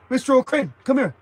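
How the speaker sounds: high, loud mock cop bark; sneering imitation of a small-town cop; mocking tough cop. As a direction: ham up the bossy cop calling him over.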